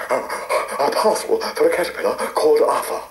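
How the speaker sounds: breathless, fast gasping words; out of breath from rushing; panting. A narrator's reading. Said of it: "he panted".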